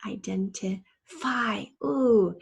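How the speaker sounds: IDENTIFY slowly by syllable, landing big on the long I of FY; long I sound. Shown as a demonstration. Teacher pointing out a long I sound, IDENTIFY slowly sounded out. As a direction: break up "Identify", then punch the final "fy". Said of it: "there is our long I sound".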